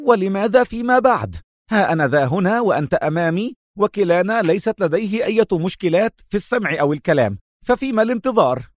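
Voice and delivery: high-pitched, quick irritation; testy, hurried protest; agitated. A narrator's reading. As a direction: demand it impatiently, pitch up high. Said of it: "قال رأفت في عصبية".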